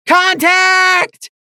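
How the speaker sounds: high, loud scream; screamed alarm. A narrator's reading. Mare spotting the enemy, screaming alarm. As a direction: scream the warning into the night. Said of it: "a mare screamed in the night".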